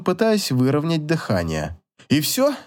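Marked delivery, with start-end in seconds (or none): 2.1-2.6 much higher, protesting question; almost cry out, pitch leaping up; near outcry. A narrator's reading. Husband protesting to his wife as she stops, near outcry. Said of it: "практически вскричал Кайл".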